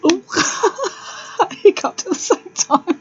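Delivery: giggling through the words; laughing while explaining. Real, not acted. Laughing at her own hiccup.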